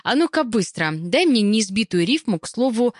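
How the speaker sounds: brisk, bossy phone request; urgent demand. Acted. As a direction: demand it at once. Friend urgently gets down to business.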